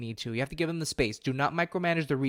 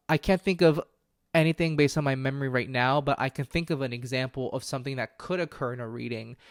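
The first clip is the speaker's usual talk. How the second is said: normal talking voice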